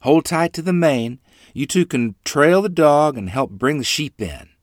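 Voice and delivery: instructing mother. A narrator's reading. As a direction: tell the kids firmly but kindly what to do.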